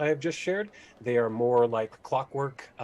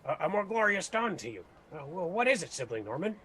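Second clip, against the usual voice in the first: lightly raised, overly friendly orc; cheerful orc; 6 semitones higher than usual. Acted. Orc answers the gnome with mild sweet cheer. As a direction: greet with a sunny smile. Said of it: "walks over and says".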